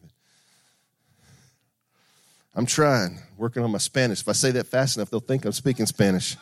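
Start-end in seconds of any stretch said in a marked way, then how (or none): none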